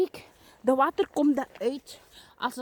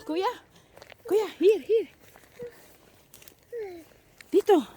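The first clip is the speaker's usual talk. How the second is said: calling out; 5 semitones higher than usual. Real, not acted. Calling to older brother.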